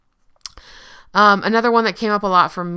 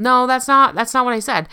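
defensive denial; 7 semitones higher, 6 dB louder than usual. Retold or imitated. Acted denial in argument.

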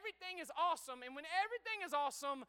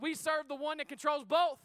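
ordinary talk

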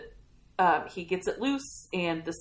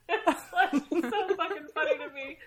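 higher voice still full of laughter; laughing; 11 semitones higher, 6 dB louder than usual. Real, not acted. Host telling listeners the scene is funny, laughing. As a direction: speak through the last of your laughter.

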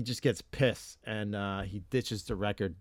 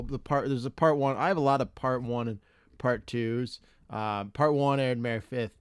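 ordinary talk

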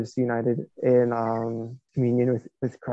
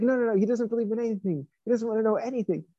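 much higher, insistent and worked-up; insistent protest; 9 semitones higher, 5 dB quieter than usual. Retold or imitated. Italian man insisting to the priest, worked-up high protest. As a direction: insist nothing will change him. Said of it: "he's like".

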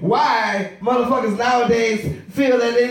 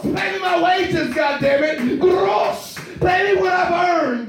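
mock hero shout; 6 semitones higher, slower than usual. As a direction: yell it like a cartoon avenger gone wild. Captain Planet rampage yelled.

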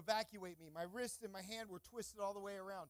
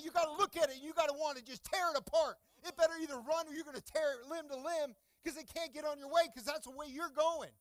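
ordinary talk, nothing marked

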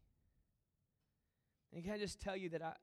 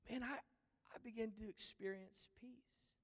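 slow, whispered gratitude; hushed relief; 4 semitones higher, 4 dB quieter, slower than usual. Real, not acted. Pastor quietly telling the congregation of finding peace. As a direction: let it settle softly. Tender turn after climax.

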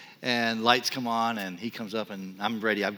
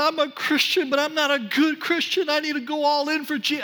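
much higher, louder desperate confession; retold blurting out; 9 dB louder than usual. Blurting a confession to the state trooper, loud and high. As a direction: pour it all out at the trooper in a burst. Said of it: "I just blurted it all out".

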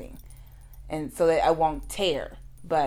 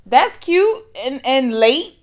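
sarcastic; 8 semitones higher, 8 dB louder, slower than usual. Real, not acted. Sarcastic comment after laughing.

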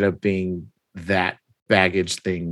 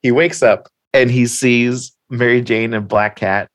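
ordinary talk

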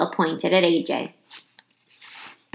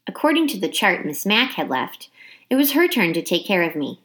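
ordinary delivery

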